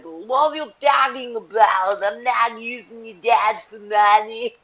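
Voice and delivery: loud, drawn-out mock protest; hammed-up whiny denial; mock husband protesting. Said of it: "He's like".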